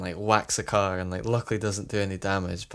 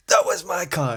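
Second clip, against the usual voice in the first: higher, angry teacher yell; mock angry yell; 7 semitones higher, 3 dB louder than usual. Teacher shouting at the kid who hit his car, mock yell. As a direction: retell the teacher's fury half-hearted. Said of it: "absolutely goes through me".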